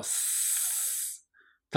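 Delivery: long soft "sss" like air escaping; sustained voiceless s sound; air-leak hiss. Shown as a demonstration. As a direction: hiss like a punctured tyre tube. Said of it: "空気がスーッと".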